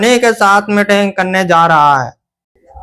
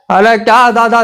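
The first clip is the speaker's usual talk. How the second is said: ordinary talk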